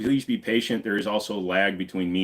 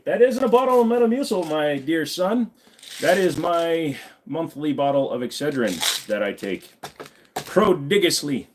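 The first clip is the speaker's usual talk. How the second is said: mock-grand declaiming; 6 semitones higher, 3 dB louder than usual. Streamer correcting his chat about the bottle, mock-grand. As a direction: declaim it with exaggerated grandeur, slowing down.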